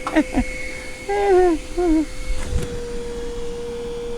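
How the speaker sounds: long high giggle; giggling laugh. Real, not acted. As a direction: giggle helplessly, trailing on. Laughs about caught pants.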